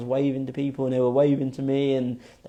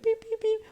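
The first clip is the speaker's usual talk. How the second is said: high beeping bird call; tiny high-pitched tweets; imitated bird tweeting; 4 dB quieter than usual. Storyteller imitating an eagle's tweet, high beeps.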